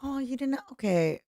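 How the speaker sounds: ordinary talk, nothing marked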